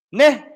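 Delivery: the Cape word "Ne." said as a short, high tag question; saying ne. Shown as a demonstration. Teacher to class, demoing the Cape "Ne." high and bright. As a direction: bounce "Ne." up like a Capetonian asking "right?". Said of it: "We say ne".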